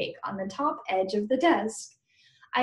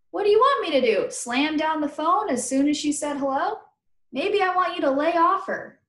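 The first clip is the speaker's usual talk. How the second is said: fast, louder defensive retort; heated argument; 6 semitones higher, 4 dB louder than usual. Acted. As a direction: snap back at him fast. Argument about the phone call.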